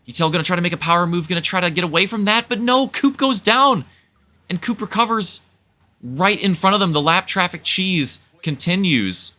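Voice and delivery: fast, high race call; excited play-by-play. Real, not acted. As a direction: call the action as it unfolds. Live call of lead battle.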